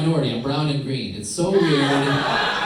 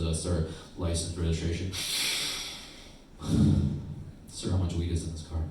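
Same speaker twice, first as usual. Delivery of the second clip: mock cop voice; 6 semitones lower, 6 dB quieter than usual. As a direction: impersonate a bored traffic cop, lower. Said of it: "the cop comes to my window and just goes".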